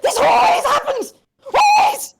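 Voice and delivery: high, loud panicked shouting; frantic shout. Acted. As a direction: rant at the top of your voice. Frantic NPC throwing things.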